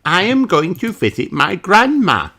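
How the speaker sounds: put-on high, eager little girl voice; Peppa's voice. A narrator's reading. Peppa speaks her stage line to the audience, eagerly. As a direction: chirp it like a child acting.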